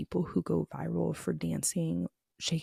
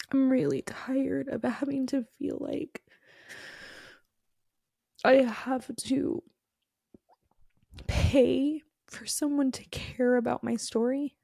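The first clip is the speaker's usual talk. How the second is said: on the verge of tears; 6 semitones higher than usual. Real, not acted. Tearful confession about rejection.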